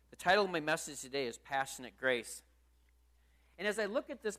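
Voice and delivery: ordinary talk, nothing marked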